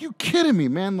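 high-pitched, whiny protest; indignant disbelief. Retold or imitated. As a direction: complain in an incredulous high voice.

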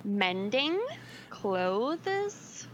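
slow, separated words, each rising at the end; really slow. Real, not acted. Repeating it for a co-host who asked, really slow. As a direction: spell it out slowly, "clothes" stretched into two syllables. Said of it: "Did you just say that really slow".

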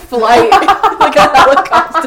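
high, loud words through laughter; laughing hard. Real, not acted. Joking to her friend about a ponytail flying, loud laughter. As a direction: crack up as you say it.

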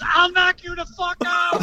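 retold scream. Dad retelling Clarence getting involved in the fight, mock scream. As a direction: act out someone else's yelled threat. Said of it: "Clarence just screamed".

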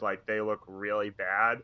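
ordinary delivery

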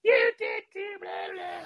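high squealed retort, tailing into babble; mock kid tantrum in falsetto; screaming. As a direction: imitate a wailing little brother. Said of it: "from a full scream and a full run".

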